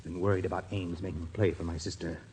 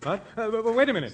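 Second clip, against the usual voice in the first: high, loud stammering panic; panicked stammer; 5 dB louder than usual. Acted. Brother realising he is suspected of murder, high panicked stammer. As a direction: stumble over the words in fright.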